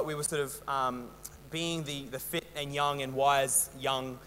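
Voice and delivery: usual speaking voice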